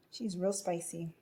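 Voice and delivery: ordinary delivery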